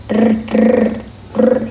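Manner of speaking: mouth-made phone ringing trill; phone ring imitation. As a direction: buzz it out like a cheap ringtone.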